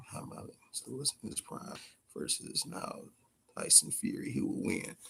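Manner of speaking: barely voiced, murmured aloud; whispered reading. Real, not acted. Streamer reading a viewer's matchup question, whispered. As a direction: read the viewer's question under his breath.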